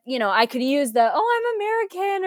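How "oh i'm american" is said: high, put-on excuse voice; much higher, hammed-up plea of ignorance; playing innocent newcomer. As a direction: pipe up with a mock-innocent excuse.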